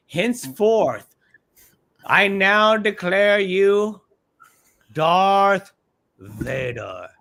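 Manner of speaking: slow, grand announcement of the title; mock proclamation. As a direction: declare it like a herald crowning someone.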